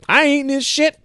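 high, loud indignant yell; mock outrage, shouting he wants meat; a man refusing a veggie sandwich, yelling high and loud; furious yelling man. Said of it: "And then goes".

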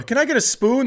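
mock customer request. Customer asks rude restaurant server, high mock request. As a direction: pitch it way up as a pleading diner, imitated.

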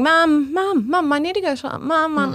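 put-on high-pitched kid calling for mum; pestering child. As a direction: imitate a child's insistent, repeated nagging. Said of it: "I can hear Cuba going".

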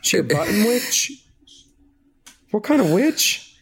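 high incredulous question; baffled. Real, not acted. Baffled at an outfit.

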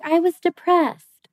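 high, slow, dismissive mock of someone oversharing vaguely; mocking vague confession. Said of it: "And they're like".

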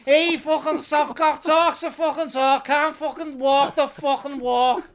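jeering Liverpool bloke hurling insults; very angry Scouser. Retold or imitated. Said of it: "impersonating a very angry Scouser".

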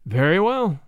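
measured, deliberate assent; slow, deliberate. A narrator's reading. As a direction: say it slowly and firmly. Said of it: "he said slowly".

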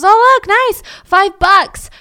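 high, loud, delighted squeal over the cash; gleeful find. Acted. Dad gloating over money found in a purse, high delighted squeal.

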